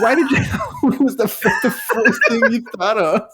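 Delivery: real laughter while quoting himself; laughing. Real, not acted. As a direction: laugh through the question. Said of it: "laughing while retelling".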